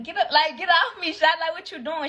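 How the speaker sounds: loud, high startled yell at the guard; mock yell. Yelling at the store lady to get off. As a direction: shout her off like a scared teen.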